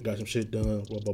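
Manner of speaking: usual speaking voice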